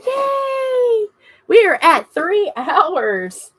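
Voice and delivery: high loud yay, then slow proud news; tearful happy shout; excited cheer. Real, not acted. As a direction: celebrate the milestone. Stream reaches three hours.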